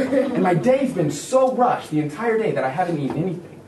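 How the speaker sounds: ordinary talk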